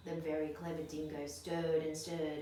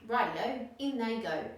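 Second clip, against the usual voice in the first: higher, louder cheerful agreement; cheery dingo; 5 semitones higher, 6 dB louder than usual. Acted. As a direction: toss it off gleefully.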